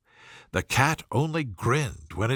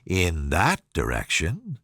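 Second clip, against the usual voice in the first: low, sly cat; lower Cheshire Cat voice; 9 semitones lower than usual. A narrator's reading. The grinning cat answering Alice, lower and sly. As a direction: drop lower and tease her.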